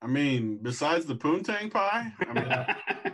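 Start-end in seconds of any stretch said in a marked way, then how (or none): 1.2-3.1 laughing. Real, not acted. Crude joke, laughing.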